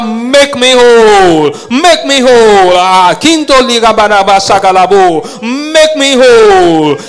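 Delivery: high, loud shouted preaching; impassioned revival preaching, shouting to the church; full-force preaching. Acted. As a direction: climb to a roaring altar call.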